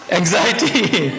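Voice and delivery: drawn-out word through laughter; real chuckle on anxiety; laughing. Real, not acted. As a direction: laugh it out slowly. Laughing at own breakup dread.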